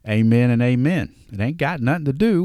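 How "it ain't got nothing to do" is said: real Southern drawl slipping out. Real, not acted. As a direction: let the Southern drawl come out, a bit higher. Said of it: "that's my Southern drawl coming out".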